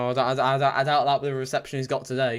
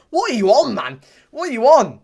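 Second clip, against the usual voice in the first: much higher and louder, real exasperated shout; outraged yell; 9 semitones higher, 9 dB louder than usual. Real, not acted. Fan yelling at a player, outraged shout. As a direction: yell at the screen in disbelief.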